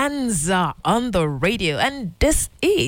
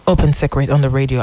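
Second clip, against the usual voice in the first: lower, louder show title announce; radio announcer; 6 semitones lower, 4 dB louder than usual. Acted. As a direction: present the show name like a promo.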